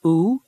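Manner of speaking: "Oo" as a long rounded oo vowel, as in food; long oo sound. Shown as a demonstration. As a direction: hold "Oo" as a long, tight, rounded oo. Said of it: "Which sound is in the words".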